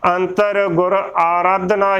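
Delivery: ਆਰਾਧਨਾ opening on a full long "aa"; correct pronunciation. Shown as a demonstration. Teacher correcting the congregation's reading, careful pronunciation. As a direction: say ਆਰਾਧਨਾ as "aa-raadhna". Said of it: "ਹੀ ਉਚਾਰਨ ਕਰਨਾ ਹੈ".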